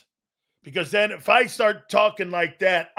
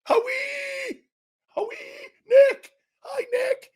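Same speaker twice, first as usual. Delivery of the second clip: high shrieking call of a starstruck fan; screaming fangirl; slower than usual. Retold or imitated. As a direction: shriek their names like a teen at a concert. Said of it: "like he's fourteen years old at a [name] concert".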